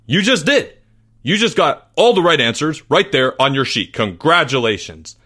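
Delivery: exasperated teacher. Acted. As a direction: argue it hard, punching key words. Teacher argues with doubting pupil.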